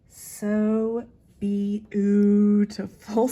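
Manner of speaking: very slow, loud gushing; drawn-out admiration. Real, not acted. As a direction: stretch every syllable in admiration. Gushing over the antique ribbon.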